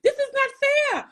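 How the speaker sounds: very high, laughing mock outrage; retold disbelief. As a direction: replay the stunned phone call, laughing. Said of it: "I said".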